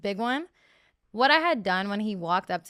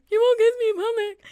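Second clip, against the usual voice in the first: whiny mock; 11 semitones higher, 6 dB louder than usual. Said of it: "You're like".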